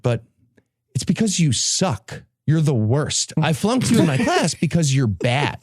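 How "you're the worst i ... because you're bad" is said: ordinary delivery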